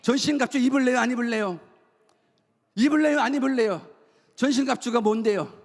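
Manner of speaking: high, driving sermon delivery; impassioned preaching. Real, not acted. Pastor warning the congregation about self-centred living, impassioned preaching. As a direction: preach hard, stretching out the key words.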